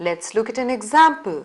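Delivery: ordinary talk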